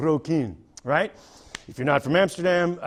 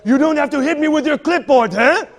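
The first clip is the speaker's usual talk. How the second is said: higher, louder, aggressive taunting; angry Dutch cyclist; 8 semitones higher, 8 dB louder than usual. Retold or imitated. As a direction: bark it at him, spoiling for a fight. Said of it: "and he's like".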